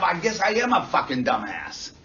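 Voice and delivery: acted frustrated shout. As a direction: shout it out, angry at yourself.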